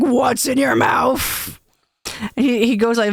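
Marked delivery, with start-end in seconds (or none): 0.0-1.6 slow, drawn-out suspicious question; dog owner scolding. Retold or imitated. Owner looks at their dog, slow and suspicious. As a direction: imitate an owner catching a dog chewing. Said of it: "an owner looks at their dog".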